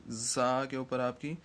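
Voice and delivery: ordinary delivery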